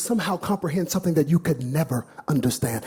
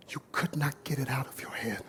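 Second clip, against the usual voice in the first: whisper; 4 semitones lower, 13 dB quieter than usual. Real, not acted. Dramatic hush to crowd.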